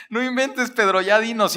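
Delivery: no way, just tell us already; mock disbelief. Listener urging Pedro, preacher joking. (incredulidad exagerada y juguetona, voz mucho más aguda)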